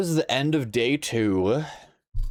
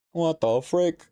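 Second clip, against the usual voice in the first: disbelief; 8 semitones higher than usual. Real, not acted. Repeated exclamation, higher.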